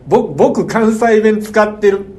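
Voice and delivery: mock show-off newcomer from Kansai; 東京来たての関西人. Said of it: "関西人も嫌いやわ". (関西弁をひけらかす人の真似)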